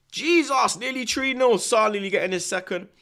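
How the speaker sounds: real burst of excitement at the match, high and loud; excited reaction. Real, not acted. Streamer reacting to Salah nearly scoring, excited. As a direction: jump up at a near goal. Reacting live to a near goal.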